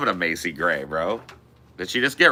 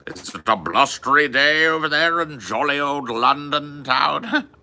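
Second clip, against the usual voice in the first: hammed-up posh British English, higher and chirpy; mock British accent; 5 semitones higher than usual. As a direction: play a jolly old Londoner for laughs. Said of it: "I'm just stereotyping the fuck out of you".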